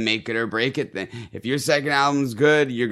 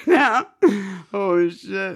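crying laughing; 8 semitones higher, 4 dB louder, slower than usual. Real, not acted. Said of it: "Oh, I'm crying".

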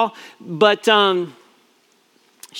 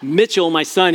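usual speaking voice

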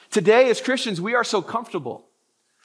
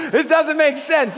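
laughing; 4 semitones higher than usual. Real, not acted. Laughing at a joke.

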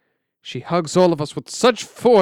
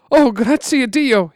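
joyful shout; 10 semitones higher, 7 dB louder than usual. Acted. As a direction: shout thanks to God. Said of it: "he shouts".

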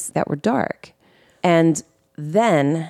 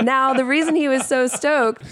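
ordinary talk, nothing marked

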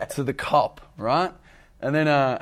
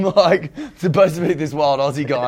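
normal talking voice

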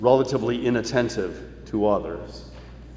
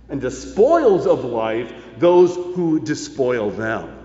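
slow, louder, higher proclamation of the verse; preaching scripture; 4 semitones higher, 3 dB louder than usual. Acted. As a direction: thunder the verse from the pulpit. Quotes Proverbs with rising force.

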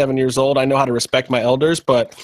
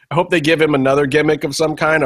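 normal talking voice

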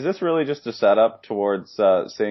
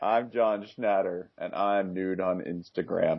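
slow, softer pitchman impression; John Schnatter; 6 dB quieter, slower than usual. Podcaster spoofing the pizza founder's ad, slow hushed impression. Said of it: "didn't quite get the, the pace of the verbiage".

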